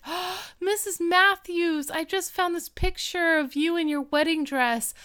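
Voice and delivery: put-on high, gushing girlish delight; gasp, then gush as a bubbly girl; bouncy excitement. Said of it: "like, bouncing down the stairs".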